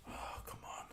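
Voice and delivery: a near-tearful whispered complaint; despairing whisper. Real, not acted. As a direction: breathe it out like you're about to cry. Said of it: "I'm gonna cry in a minute".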